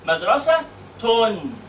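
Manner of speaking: MADRASA-TUN, slow, split before a long "tun"; damm plus noon. Shown as a demonstration. Teacher breaks down the word for pupil, slow MADRASA-TUN. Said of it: "ضمة ونون".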